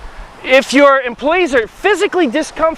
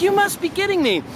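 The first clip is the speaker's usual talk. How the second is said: incredulous; 7 semitones higher than usual. Retold or imitated. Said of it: "I'm like".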